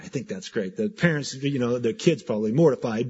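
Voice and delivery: normal talking voice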